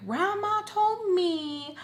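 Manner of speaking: high, drawn-out mock yell; retold screaming match. Daughter protesting about the living situation, retold mock yell. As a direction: wail it out slowly in protest, imitating an argument. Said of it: "we start screaming at each other".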